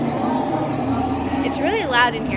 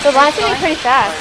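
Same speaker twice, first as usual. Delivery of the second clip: said louder so Tiffany hears; louder repeat; 3 semitones higher, 9 dB louder than usual. Real, not acted. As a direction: raise your voice over the noise. Repeats after Tiffany's "What?.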